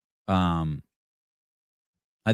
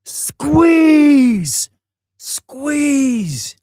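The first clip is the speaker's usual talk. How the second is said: very high, loud shout from the sidelines; coaching yell; 10 dB louder than usual. Retold or imitated. Dad cheering his kid on at a jiu-jitsu tournament, shouting. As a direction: bellow encouragement to the mat. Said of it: "and I yell".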